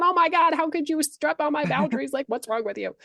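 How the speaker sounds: high, hammed-up outraged outburst; freaking out. Worker confronting the partner over boundaries, freaking out. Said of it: "don't come at the partner and be, like, freaking out".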